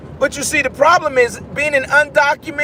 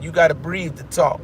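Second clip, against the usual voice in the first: ordinary talk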